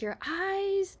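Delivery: high mock swooning; Joey. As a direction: imitate him gushing, voice way up. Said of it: "he's like".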